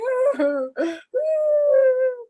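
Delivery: long high crying wail; high wailing sob; high sobbing wail. Acted. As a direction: let out a child's sob. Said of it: "she's just crying".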